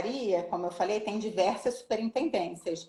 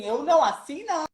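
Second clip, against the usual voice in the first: higher voice, firm "não" at the end; deaf woman refusing; 6 semitones higher, 4 dB louder than usual. Retold or imitated. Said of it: "Aí ela fazia assim pra mim". (recusa firme, voz mais aguda)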